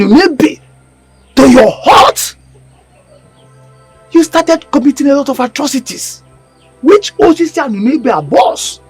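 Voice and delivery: loud, high, forceful accusation; thundering denunciation; angry rebuke. Acted. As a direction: hurl the charges at him.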